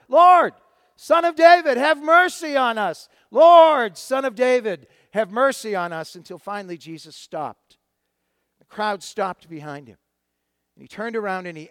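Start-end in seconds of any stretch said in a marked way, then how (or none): 0.1-6.2 high, full-voiced plea; louder shouting. Blind men shouting over the crowd's rebuke, louder shouting. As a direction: shout it with all your might. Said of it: "they responded by shouting even louder".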